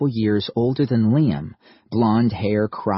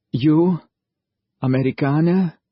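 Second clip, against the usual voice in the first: German soldier voice; 6 semitones higher, 4 dB louder than usual. A narrator's reading. Soldier asking.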